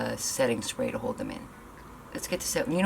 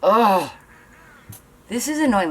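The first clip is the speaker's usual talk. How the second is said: high, louder fed-up groan; annoyed groan; 6 semitones higher, 6 dB louder than usual. Real, not acted. To viewers as the curl fails, annoyed groan. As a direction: let the irritation burst out. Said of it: "I'm getting annoyed".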